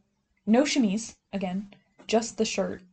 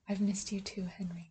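ghostly whisper; 10 dB quieter than usual. Retold or imitated. Jane's ghost voiced, whispered.

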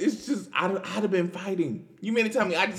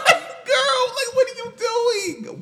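high laughing disbelief; 7 dB louder, slower than usual. Real, not acted. Exasperated at the mother.

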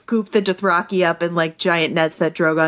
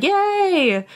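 cheering; 12 semitones higher than usual. Retold or imitated. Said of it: "Yara and Theon were like".

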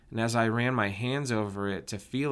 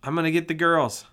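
high and loud, eager to run; excited; 7 semitones higher, 7 dB louder than usual. Acted. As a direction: call out eagerly. Boy runs off excited.

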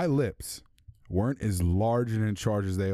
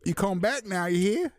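high-pitched imitation of a Southern drawl; quoting the Indian burrito owner in a Southern accent; 11 semitones higher than usual. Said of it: "And she said".

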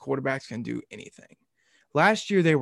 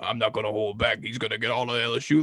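Coach Boyd impression; 4 semitones lower than usual. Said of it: "what I said in the Coach Boyd voice".

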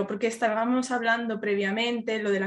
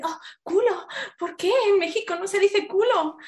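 imitating shocked people; 8 semitones higher than usual. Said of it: "he visto vídeos que la gente".

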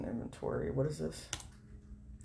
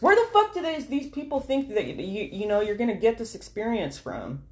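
much higher, louder outraged rant; exasperated rant; 8 semitones higher, 6 dB louder than usual. Real, not acted. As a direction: vent the outrage at absurd demands. Ranting at job requirements.

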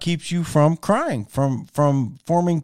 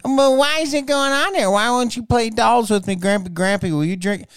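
put-on high little-girl voice, pleading; granddaughter voice; 9 semitones higher than usual. Grandpa mimics his granddaughter begging to play, in a high child voice. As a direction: squeak it up like a small granddaughter nagging.

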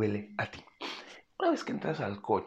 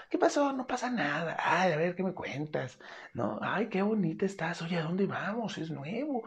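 higher, cheerful and bright-toned chatter; upbeat positive; 8 semitones higher than usual. Shown as a demonstration. Owner chatting cheerfully to the cat in the carrier, upbeat. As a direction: keep it happy and light for the cat. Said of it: "Vete hacia arriba".

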